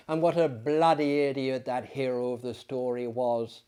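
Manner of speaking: plain reading voice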